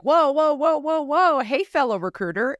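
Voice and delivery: loud, high-pitched "whoa" to stop her; caller slowing her down. Retold or imitated. Fellow recruiter on the phone stopping her fast talk, loud and high. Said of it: "he was like".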